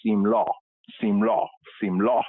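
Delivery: Simlaw with a drawled aw ending; drawled accent saying Simlaw. Shown as a demonstration. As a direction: stretch simla into Simlaw, southern-style drawl. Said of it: "if you have a drawl in your accent".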